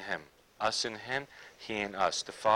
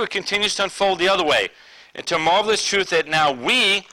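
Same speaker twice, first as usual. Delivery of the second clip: loud, higher-pitched proclamation; full-force preaching; 4 semitones higher, 8 dB louder than usual. Acted. Preacher proclaims scripture to the church, emphatic preaching. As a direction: drive the verse home, raised voice.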